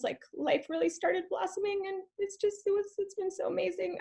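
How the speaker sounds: high, soft voice choking up with tears; fighting back tears as she speaks; tearful, voice breaking. Real, not acted. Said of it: "I get emotional when I talk".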